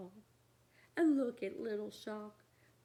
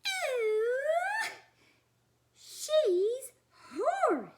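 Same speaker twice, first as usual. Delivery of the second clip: high squeal then breathy whispered awe; delighted gasp; 13 semitones higher, 7 dB louder, much slower than usual. Acted. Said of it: "gasped Mister and Missus Monster happily".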